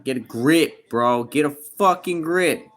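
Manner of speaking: loud, building to a slow yell on grip; scolding. Real, not acted. Scolding a viewer for waiting five years, loud and slow. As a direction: bark it like a fed-up big brother.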